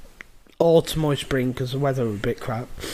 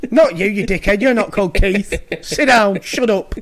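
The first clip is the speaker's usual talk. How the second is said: mock telling-off; 4 semitones higher, 5 dB louder than usual. Mum telling off uncle, laughing.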